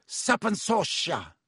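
high, slow incantation; bellowed spell. A narrator's reading. Malfoy raising his wand at Harry, bellowed spell. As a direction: cry out the spell, drawing it out. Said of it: "quickly and bellowed".

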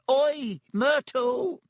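high, loud bellow across the room. Acted. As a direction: yell her name with full lungs. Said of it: "He took a deep breath and bellowed".

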